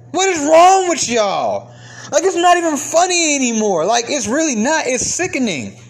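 high-pitched, insistent exasperated rant; real exasperation. Real, not acted. Man asks women what is wrong, exasperated. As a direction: demand an answer, stretching the key words.

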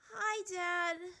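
high, sheepish greeting; meek. A narrator's reading. Runaway princess facing her father, meek. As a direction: greet him meekly in a high little voice. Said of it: "she said meekly".

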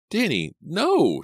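high, loud, drawn-out protest; distressed cry. A narrator's reading. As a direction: cry out in alarm, stretching the words. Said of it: "she cried".

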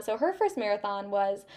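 ordinary talk, nothing marked

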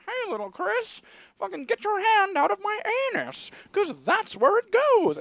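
very high squeaky puppet voice; squeaky ventriloquist dummy. Retold or imitated. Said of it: "his mouth isn't moving at all".

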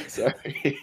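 laughing through an apology; giggling real laughter. Real, not acted. Laughing at mistake.

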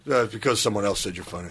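low, grudging answer; reluctant dude. Retold or imitated. Dude explaining why he wants a picture, grudging. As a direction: answer in a low, unimpressed guy voice. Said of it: "dudes coming up who do not want to give".

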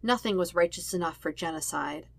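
ordinary delivery

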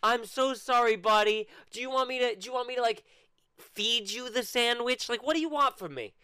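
put-on high, sarcastic baby-talk pity; mock sympathy. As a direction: coo at him like a whiny toddler.

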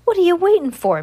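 very high, loud shout; a husband yelling at his partner to shoot; furious shout. A narrator's reading. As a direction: yell at the gunman to act. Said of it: "Mister Bailey shouts".

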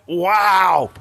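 loud, high, drawn-out wow; hammed-up astonished reaction; mock amazement. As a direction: imitate stunned listeners with a big wow. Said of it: "wanted everyone listening going".

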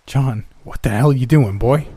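put-on loud, high outburst from the startled old man; shocked angry shout. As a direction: bark it at the intruder in alarm.

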